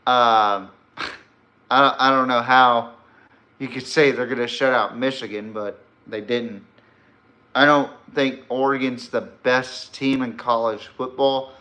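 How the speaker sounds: ordinary delivery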